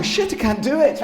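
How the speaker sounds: high, loud mock despair; comic panic. Actor retelling failing the accent in the booth, mock panic. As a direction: blurt it in exaggerated dismay, half laughing.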